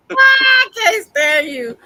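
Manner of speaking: high, slow mock sob; fake crying. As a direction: whine it like a hammed-up sob.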